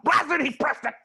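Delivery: high-pitched cry of dismay; exasperated outburst. Acted. Max sees the moose press it, exasperated outburst. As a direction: burst out in frustration.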